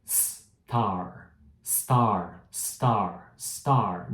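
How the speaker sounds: "star" repeated with a clean s each time; drill. Shown as a demonstration. Teacher leading students in a repeated "star" drill. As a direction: drill "star" over and over, no vowel first. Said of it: "pratica aí comigo".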